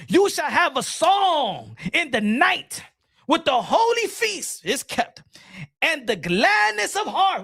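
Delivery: high-pitched, whooping mock sermon; hammed-up revival preacher shouting scripture; fired-up preacher. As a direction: preach it with soaring pitch and drawn-out words. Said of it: "I need a preacher".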